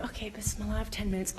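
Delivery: hushed whispered prayer, breath only. Retold or imitated. Praying alone by the parking lot, whispered. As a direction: whisper it so no one hears.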